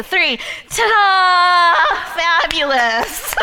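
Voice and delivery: loud, high, long-drawn diva flourish; ta-da with attitude. Shown as a demonstration. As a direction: shout it with showy attitude, stretching the vowels. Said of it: "bring a little attitude".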